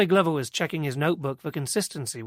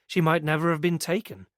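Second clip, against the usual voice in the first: usual speaking voice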